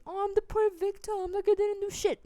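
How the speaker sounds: high, loud whiny victim voice; mock poor victim. Refusing to play the poor victim, mocking high whine. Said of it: "I'm not gonna sit here and say".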